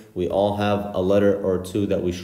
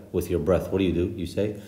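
ordinary talk, nothing marked

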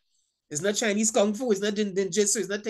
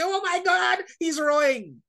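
mock panic; 8 semitones higher than usual. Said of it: "and you say".

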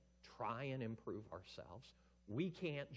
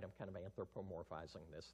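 ordinary talk

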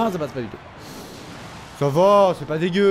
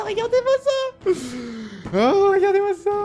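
delighted squeal. Real, not acted. Gamer showing off his winning move, high delighted squeal. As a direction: crow high with glee.

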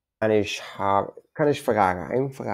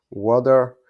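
American water; 7 dB louder than usual. Shown as a demonstration. Teacher shows how Americans say "water". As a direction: say "water" the American way, loud "wadder". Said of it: "So they are saying water".

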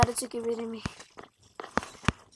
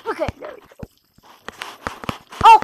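usual speaking voice

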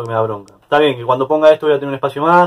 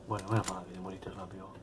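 breathy whispered aside to the dead enemy; 7 semitones lower, 22 dB quieter than usual. Real, not acted. Player whispering to the zombie just shot down, relieved whisper. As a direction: whisper it like a secret gloat. Mocking a freshly killed zombie.

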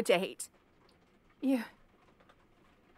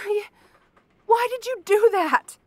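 high, loud, stunned and hurt; hurt shocked cry; 8 semitones higher, 9 dB louder than usual. Acted. Slapped by the captor, asking why in a hurt high cry. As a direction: cry out in pain and disbelief.

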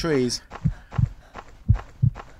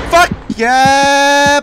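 triumphant shout; 12 semitones higher, 11 dB louder than usual. Real, not acted.

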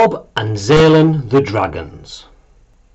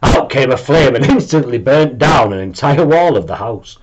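plain reading voice

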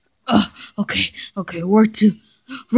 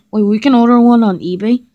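ordinary talk

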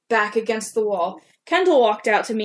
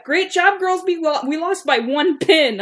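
loud, high sarcastic retort; yelling back; 7 semitones higher, 4 dB louder than usual. Acted. [name] snapping back at teammate after the loss, loud. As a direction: throw the blame right back at her. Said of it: "I yelled back at her".

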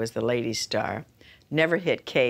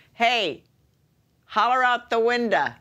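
higher, louder brash New Yorker; brash American; 9 semitones higher, 5 dB louder than usual. Retold or imitated. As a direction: holler it like a brash local.

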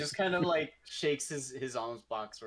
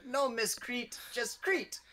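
high-pitched little kobold; 11 semitones higher, slower than usual. Acted. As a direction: pipe it up high, correcting him. Kobold character answers the knight back.

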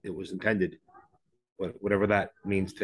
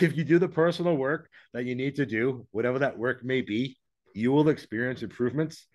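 ordinary talk, nothing marked

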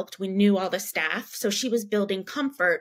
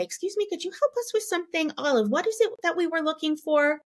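high, chirpy, a little fast; bright retail voice; 8 semitones higher, faster than usual. Retold or imitated. Mom brightly asking a store employee for help, high and chirpy. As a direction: sing it out brightly to a store clerk.

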